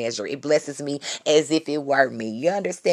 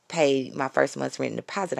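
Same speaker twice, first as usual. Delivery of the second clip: normal talking voice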